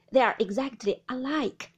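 loud, emphatic angry contradiction; in a passion. A narrator's reading. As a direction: contradict her forcefully. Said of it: "into a passion".

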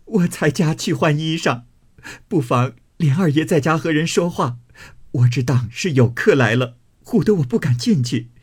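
high, whimpering account through tears; tearful complaint. Acted. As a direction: plead your case tearfully to an elder. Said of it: "凤姐儿哭道". (哭诉，带着哭腔诉委屈)

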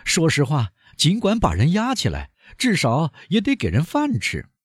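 indignant banker. A narrator's reading. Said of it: "坦格拉尔大声地说".

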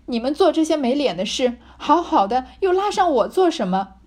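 wronged maid shouting back at the couple; angry scolding. A narrator's reading. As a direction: lash out, wronged and furious. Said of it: "骂道".

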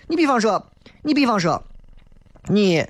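ordinary talk, nothing marked

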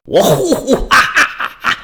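high, hammed-up belly laugh; hooting stage laugh rolling into ha-ha-has; acted mocking guffaw. A narrator's reading. Elder on the city wall scorns the Roman, acted guffaw. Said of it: "城上的元老是哈哈大笑".

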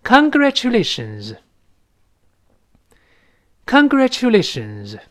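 high, slow, projected model word; read aloud together. Shown as a demonstration. Teacher asking students to repeat aloud, high slow model reading. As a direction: call it out slowly for repetition, starting high. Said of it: "我们跟老师大声再读一遍".

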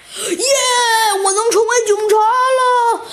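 hammed-up cheer of a little chick; overjoyed shout. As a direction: burst out with joy too loud. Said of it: "你小声点儿".

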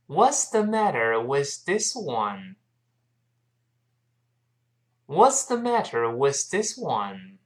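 slow, drawn-out model for learners to follow; unhurried teacher's demonstration; slow reading. Shown as a demonstration. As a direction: read it out slowly, word by word. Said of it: "我们慢慢读一下这句话".

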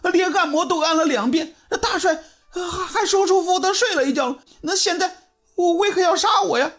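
high, stammering tearful plea; crying. Acted. Young barber pleads for his life to the officer, crying. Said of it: "哭着说".

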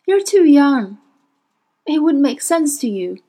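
high, pained confession; sad. A narrator's reading. Witch explaining her love to Will, sad. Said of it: "shook her head sadly".

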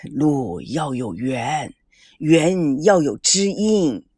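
slow, low, measured pronouncement; 缓缓吟诵. A narrator's reading. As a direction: draw each word out low and deliberate. Elder recites a weighty saying. (低沉缓慢地吟出)

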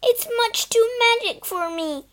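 frightened wail. Acted. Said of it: "shrieked Phyllis".

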